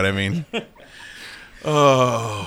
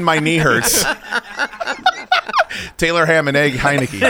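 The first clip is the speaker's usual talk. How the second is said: laughing; 4 semitones higher than usual. Real, not acted. Cracking up at pun names.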